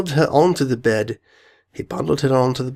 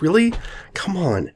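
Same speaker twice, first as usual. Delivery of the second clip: ordinary delivery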